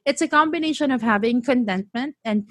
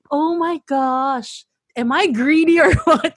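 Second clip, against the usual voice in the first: slow, then laughing and loud; laughing self-mock; 3 semitones higher, 5 dB louder than usual. Real, not acted. Asking herself about greed, laughing. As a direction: drag it out then crack up.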